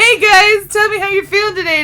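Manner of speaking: high, loud cheery greeting; chirpy fan comment. Pretend. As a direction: sound like an eager fan. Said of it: "Be like".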